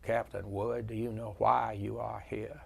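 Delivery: a hint of a soft-spoken Virginia general; soft Virginia accent. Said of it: "he had that soft Virginia accent".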